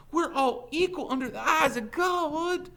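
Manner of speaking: high, loud, ringing protest; impassioned declaration. A narrator's reading. Teacher standing up to the sheriff, impassioned. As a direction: proclaim it with conviction. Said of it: "she declared".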